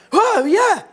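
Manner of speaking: very high, loud mock surprise; sudden realisation. Student finally notices he is wet, loud mock surprise. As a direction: blurt it out as the imitated oblivious student.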